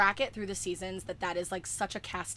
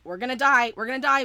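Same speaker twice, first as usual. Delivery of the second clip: loud, raised-pitch mock despair; Dean panicking; 4 semitones higher, 9 dB louder, faster than usual. Fan retelling Dean trapped in that room, loud panic. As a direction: push it out loud like impending doom. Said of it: "and Dean's like".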